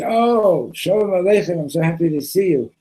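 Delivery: a lonely castaway thrilled at last to see another person; overjoyed greeting. Retold or imitated. Stranded man joyfully greets the yacht owner, in a high, excited voice.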